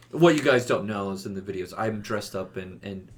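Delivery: usual speaking voice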